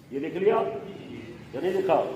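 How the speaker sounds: normal talking voice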